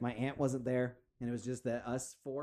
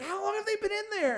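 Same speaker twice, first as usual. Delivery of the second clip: much higher incredulous dad; mock high dad; 4 dB louder than usual. Dad asking about freezer rats, high squeak. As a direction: ask it squeaky with disbelief. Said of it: "And he's like".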